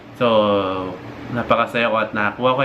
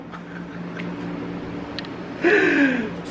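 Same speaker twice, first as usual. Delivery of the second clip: high giggle; 13 semitones higher, 5 dB quieter than usual. Real, not acted. Laughing at own story.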